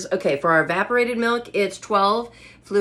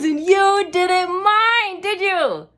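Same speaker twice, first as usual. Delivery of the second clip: high, louder, slow teasing plea; 9 semitones higher, 4 dB louder, much slower than usual. Real, not acted. Teasing the viewers about the mess, high and slow. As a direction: coax a friend to agree.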